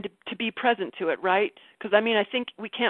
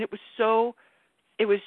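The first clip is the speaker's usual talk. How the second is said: ordinary talk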